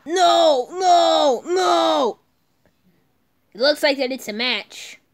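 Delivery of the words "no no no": loud high cries of no; anguished no. Acted. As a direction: wail it in despair. Despairing cries.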